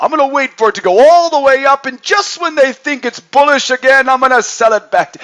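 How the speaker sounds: high, loud, scheming villain voice; mischievous villain. Retold or imitated. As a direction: gloat like a cartoon bad guy plotting. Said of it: "that mischievousness right now".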